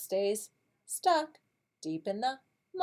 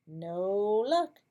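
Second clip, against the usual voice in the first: ordinary talk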